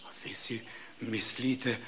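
very low, hushed question; quiet, humbled doubt; hesitant wondering. Acted. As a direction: ask softly and low, unsure of yourself. Patient doubtfully asks the doctors.

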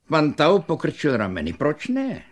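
ordinary delivery, nothing marked

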